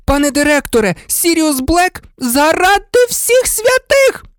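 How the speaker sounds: frantic, high-pitched outburst; hysterical exclamation. A narrator's reading. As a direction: cry out at the end of all patience. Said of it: "істерично вигукнула мадам Помфрі".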